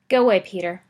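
loud grim order. Acted. Potter ordering Peter away, grim and loud. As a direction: send him off grimly. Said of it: "Potter said grimly".

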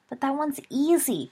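mild protesting whine to a friend; whining. A narrator's reading. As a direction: whine it like a sulky schoolboy. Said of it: "Peter whined".

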